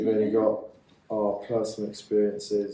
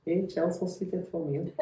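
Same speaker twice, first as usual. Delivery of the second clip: higher, laughing mock Scouse accent; 10 semitones higher than usual. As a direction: try the Scouse accent again, giggling through it. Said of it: "she said it in a Scouse accent".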